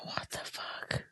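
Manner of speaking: stunned whisper, slow and breathless. Real, not acted. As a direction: murmur it in disbelief. Shocked at herself.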